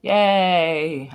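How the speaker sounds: loud, high little cheer. Real, not acted. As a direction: celebrate with a drawn-out yay. Celebrating on the livestream.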